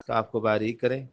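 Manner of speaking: ordinary talk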